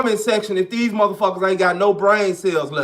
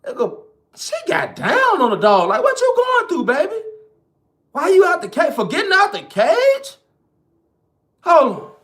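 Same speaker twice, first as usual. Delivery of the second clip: shocked reaction; 7 semitones higher than usual. Retold or imitated. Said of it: "I even had to be like".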